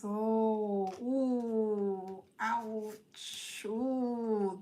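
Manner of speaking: sympathetic ooh and ouch; mock pained reaction; wincing reaction. Real, not acted. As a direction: wince at the cards. Reacting to cards.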